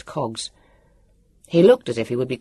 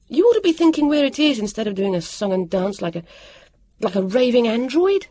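high, exasperated scolding; cross, insistent reproach; turning on someone; 9 semitones higher, 3 dB louder than usual. A narrator's reading. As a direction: tell them off, pitch raised. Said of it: "She turned on Jonathan".

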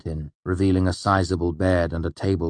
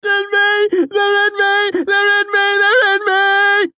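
very high, loud, frantic shrieking; panicked screaming; 9 dB louder, slower than usual. Acted. A restrained asylum patient screams in panic. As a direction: scream it over and over in terror. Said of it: "Panicked screaming burst out".

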